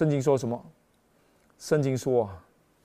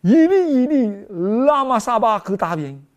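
以利 cried out high and loud; anguished shout to heaven; loud cry; 7 semitones higher, 10 dB louder than usual. Acted. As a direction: call out to God in agony. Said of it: "耶稣大声喊着说".

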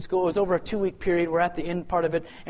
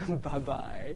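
mock nervous goodbye; 5 semitones lower, 9 dB quieter than usual. Imagined Kobe backing away.

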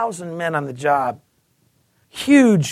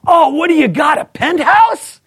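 loud, high jeering shout; mock leer; 9 semitones higher, 8 dB louder than usual. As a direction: tease him loudly. Coworker mocking his book.

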